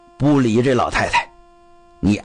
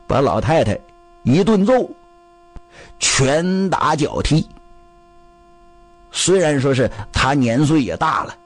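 ordinary delivery, nothing marked

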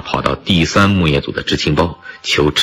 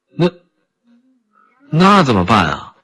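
anxious, higher-pitched question; worried questioning; 8 semitones higher, 3 dB louder than usual. A narrator's reading. Friend asks what to do about the cub, worried. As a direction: ask helplessly, with a touch of worry. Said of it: "杨克愁云满面地问".

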